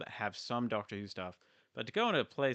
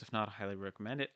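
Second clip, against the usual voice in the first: ordinary talk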